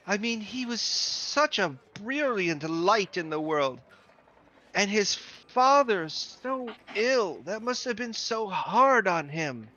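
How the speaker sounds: put-on old clerk grieving a dead boy, slow and high; mournful old woman. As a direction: drag out each sad line like a mourning old clerk. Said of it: "And she's like".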